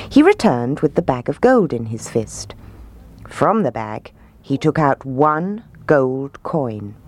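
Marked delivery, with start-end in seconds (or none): none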